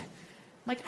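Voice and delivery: ordinary delivery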